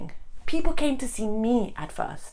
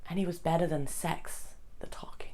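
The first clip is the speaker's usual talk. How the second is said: soft, half-whispered confession; hushed confiding; 3 semitones lower, 10 dB quieter than usual. Acted. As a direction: drop to a near-whisper, confiding. Confiding an intimate memory.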